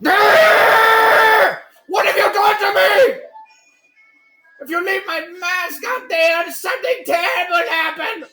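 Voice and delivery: high, alarmed shouting; panicked. Acted. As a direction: cry out in panic. Salesman panics over mask.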